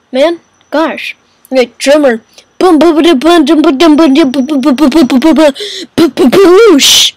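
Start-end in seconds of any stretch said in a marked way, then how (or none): 2.6-7.1 beatbox a drum riff; imitated drumming. Player voices the drummer's riff, playful drum imitation. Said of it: "that's how he sounds".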